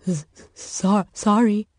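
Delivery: nervous stuttered apology; stammering mutter. Acted. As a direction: mumble it, frightened and halting. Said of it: "the man muttered".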